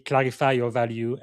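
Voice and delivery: ordinary delivery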